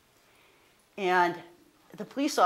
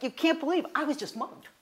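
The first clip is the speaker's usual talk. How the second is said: higher, louder mock outrage at the line; exasperated complaint; 8 semitones higher, 8 dB louder than usual. Complaining at the license office queue, exasperated. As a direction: protest the wait in a half-acted huff. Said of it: "and I was like".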